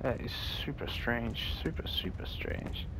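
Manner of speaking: slow, hushed whisper. Real, not acted. Player spooked in a strange room, slow whisper. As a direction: whisper it as if something might hear you.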